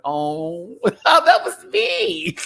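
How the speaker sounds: high, laughing, drawn-out oh that was me; cracking up at her own past self; laughing realization. Retold or imitated. Recalling girls getting sloppy drunk, laughing slowly. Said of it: "I'm like".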